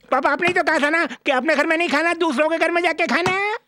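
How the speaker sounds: put-on very high, whiny overgrown child; childish comic son, wailing. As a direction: protest to papa like a small child. Comic son wails at papa.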